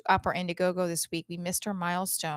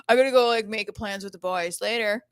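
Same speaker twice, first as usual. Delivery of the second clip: louder, casual guy blowing her off; breezy brush-off; 7 dB louder than usual. Retold or imitated. Man ditching her for the boys, breezy brush-off. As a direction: toss off a mock breezy exit. Quoting men who switch off suddenly.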